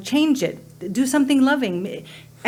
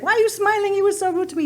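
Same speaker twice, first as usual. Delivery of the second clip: high-pitched, indignant mock complaint; retold upset; 9 semitones higher, faster than usual. Upset customer questioning the smiling server, indignant. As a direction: act out her upset disbelief, pitched way up. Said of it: "she was all upset".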